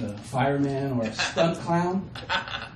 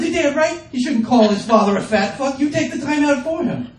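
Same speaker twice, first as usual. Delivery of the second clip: mock indignant; 8 semitones higher, 3 dB louder than usual. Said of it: "I said".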